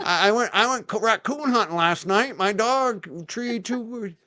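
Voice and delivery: high, hammed-up country accent; Byfield country accent. Shown as a demonstration. Bragging about last night's hunt, Byfield country accent. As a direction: stretch the last words in a high country drawl. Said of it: "this real Byfield, uh, uh, like, uh, country".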